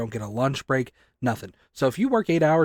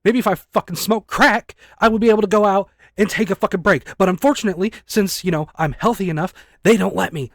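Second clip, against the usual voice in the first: louder and higher, furious venting; raised angry voice at unfair bosses; angry rant; 5 semitones higher, 5 dB louder than usual. Real, not acted. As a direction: let the frustration boil over. Angry rant about no breaks.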